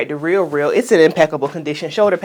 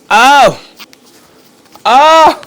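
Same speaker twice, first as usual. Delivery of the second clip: excited gasp; 7 semitones higher, 8 dB louder than usual. Real, not acted. Excited reaction to first look.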